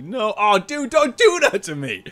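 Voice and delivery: high, loud mock dismay; dismayed protest. Host to donor who can't stay long, high mock protest. Reacts to viewer leaving for work.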